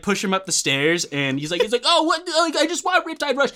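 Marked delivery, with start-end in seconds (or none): 1.8-3.5 high, laughing imitation of a clueless kid. Intruder kid explains himself to homeowner, high laughing kid voice. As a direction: play the innocent little intruder, pitched way up.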